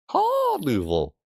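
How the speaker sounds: high, drawn-out cawing first syllable; crow caw. Acted. Streamer making the crow pun, cawing. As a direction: squawk the start of "Carnival" like a crow. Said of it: "'cause they're crows".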